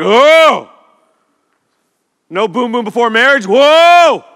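loud, high scandalized gasp; mock shock. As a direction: ham up prudish church horror. Mocking church shock about lust.

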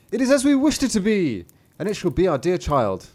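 high, loud proclamation, easing off; joyful parents. A narrator's reading. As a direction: declare it high and proud. Parents welcome their longed-for child.

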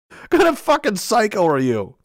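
ordinary talk, nothing marked

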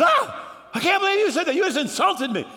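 high, loud cry of indignation; hammed-up offended outburst; outraged husband. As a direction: act out shocked outrage. Acted husband outraged at parrot's insult.

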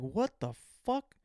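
slow, high, baffled what the fuck; incredulous. Retold or imitated. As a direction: drag it out in disbelief. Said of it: "And I'm like".

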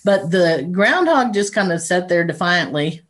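normal talking voice

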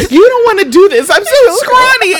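high, laughing whiny plea; pleading victim voice. Retold or imitated. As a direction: beg in a high scared voice.

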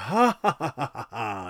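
laughing big as a fake exchange student; mock laugh. [name]'s disguised student laughing at the train party, hammed up. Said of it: "exchange student from Cameroon".

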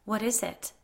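understated alarm; panicked. A narrator's reading. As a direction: ask with a touch of panic.

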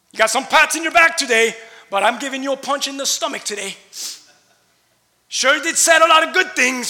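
loud, high, driving sermon voice; full-force preaching. Acted. Pastor preaching to his congregation, loud and driving. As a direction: preach it hard and loud to the room.

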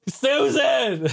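high, drawn-out wailing cry; a hammed-up high shout of despair; Virtual Boy's anguished cry. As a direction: wail it out high, like a dying console. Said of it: "Virtual Boy's like".

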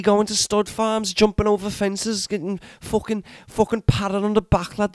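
a put-on accent drifting Welsh and Scottish; wandering Irish-ish accent. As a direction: play a ranting character in a shaky put-on accent. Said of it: "Your accent went very Welsh".

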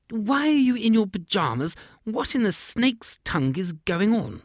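high, exasperated royal outburst; angry king yelling. A narrator's reading. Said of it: "yelled the king".

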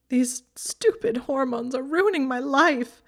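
high, loud, slow tearful wail; whining through tears. A narrator's reading. Hermione sobbing to Draco, frustrated and tearful. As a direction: wail at her own hormones. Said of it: "she was whining through her tears".

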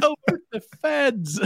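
high, slow, put-on call to the feds; mock alarm. Mocking a user who overreacts to a scam. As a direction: ham up the outrage, drawn out.

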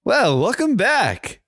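high, slow TV host welcome; late night host. Acted. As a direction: greet the studio audience like a talk show host. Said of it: "A late night host".

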